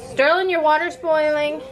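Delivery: calling out high and loud; yelling across the room. Real, not acted. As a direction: call across the house. Calling to someone elsewhere.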